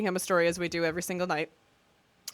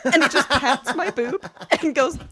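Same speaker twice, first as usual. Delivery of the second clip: laughing; 3 semitones higher, 7 dB louder than usual. Real, not acted. Laughing while retelling.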